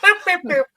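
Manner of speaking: high, squeaky boop boop of going under; sinking bubble noise. Pretend. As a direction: pop each boop like a cartoon bubble. Mimics sinking bubble sound.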